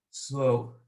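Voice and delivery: low, drawn-out word; slow. Shown as a demonstration. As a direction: stretch the word out slowly. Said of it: "First time I'm gonna say it".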